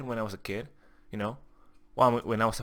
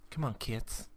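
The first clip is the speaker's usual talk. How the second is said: softer, disappointed repeat; quiet; 9 dB quieter than usual. Real, not acted. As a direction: drop the voice, almost to yourself.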